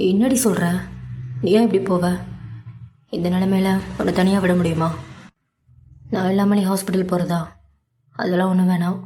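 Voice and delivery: mild, caring protest; protective objection. A narrator's reading. Husband refusing to let his wife go alone, protective objection. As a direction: push back out of concern for her. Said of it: "வருணின் குரலில் எதிர்ப்பு".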